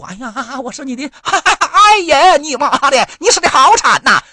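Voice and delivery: high, hammed-up fake sobbing lament, slow at first; mock wailing widow. Mocking Pelosi weeping at the Armenian memorial, high hammed-up wail. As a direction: mimic a theatrical mourner overdoing the grief. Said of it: "到那一顿哭".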